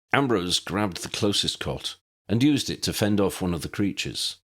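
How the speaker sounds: plain reading voice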